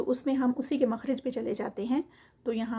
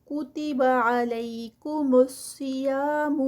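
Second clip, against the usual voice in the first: slow, drawn-out tajweed recitation; Quranic recitation; 2 semitones higher, 4 dB louder, much slower than usual. Shown as a demonstration. Teacher reciting the verse for students, slow and melodic. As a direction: chant each word slowly, stretching the vowels.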